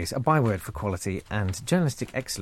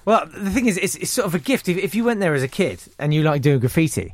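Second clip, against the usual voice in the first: usual speaking voice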